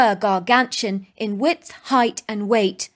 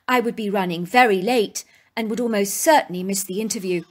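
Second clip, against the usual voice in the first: ordinary delivery, nothing marked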